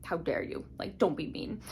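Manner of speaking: a lightly voiced grouchy protector scolding; mild mock grumbling of an old dragon; grumpy old dragon. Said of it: "big grumpy dragon guy, and he's like".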